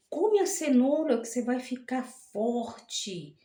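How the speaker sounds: put-on slow, gentle coaxing of a little kid; slow sweet-talk to a child. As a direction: coax a child to eat her carrots. Mother coaxing child to eat.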